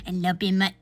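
low imitation of dad; impression of dad. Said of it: "that was my dad".

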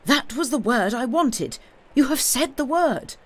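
high, agitated outburst; excited cry. A narrator's reading. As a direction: cry out as if seizing on the word. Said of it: "and he cried".

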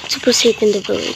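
usual speaking voice

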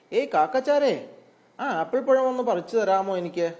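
high, loud call up to a treetop; rabbit calling up. A narrator's reading. Rabbit asks crow for the apple, calling up high. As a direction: rabbit hollering up at the crow in a branch. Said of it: "മുയൽ ചോദിച്ചു".

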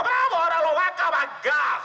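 high, excited crowd cheer; crowd shouting. Acted. Crowd sees him land on the bull, high shouting. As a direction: yell it high from the stands. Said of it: "la gente gritaba".

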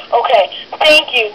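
ordinary delivery

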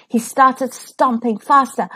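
ordinary delivery

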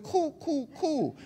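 high cooing like a pigeon. Retold or imitated. Speaker imitating the pigeon that landed, high coo. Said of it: "it went".

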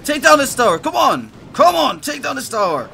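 loud urging at the screen; pleading yells; urgent shouting. Real, not acted. Urging troops on.

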